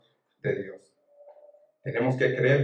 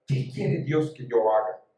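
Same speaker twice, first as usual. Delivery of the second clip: normal talking voice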